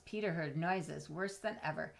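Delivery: ordinary delivery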